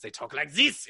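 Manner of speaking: loud, harsh "zis" in a German accent; angry German accent. Shown as a demonstration. Showing co-host Germans getting angry, loud German accent. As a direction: turn forceful with a mock German accent. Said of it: "getting angry".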